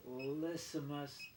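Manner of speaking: le semestre said slowly in French. Shown as a demonstration. Teaching viewers French, says le semestre slowly. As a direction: sound out le semestre carefully. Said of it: "we have the French word".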